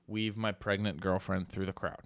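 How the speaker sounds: normal talking voice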